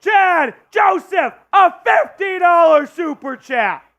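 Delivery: loud, drawn-out hype call; excited shouting. Real, not acted. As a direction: bellow it like a stadium announcer. Hyping a super chat.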